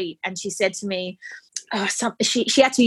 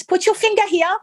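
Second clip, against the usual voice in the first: nurse instructing; 11 semitones higher, 3 dB louder than usual. Retold or imitated.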